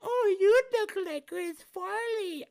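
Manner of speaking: high, loud, drawn-out mock voice; imitating a teasing onlooker. Speaker imitates people comparing their looks, mocking high voice. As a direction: stretch it out high and loud in mockery. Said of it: "when somebody says".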